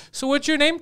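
high-pitched, teasing question; putting on a sweetly nosy caller; mock-innocent prankster. Imitating prankster's fake innocent question.

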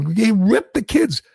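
slow, pleading parody of Biden; mocking Biden. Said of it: "Biden thinks if you sit there and go".

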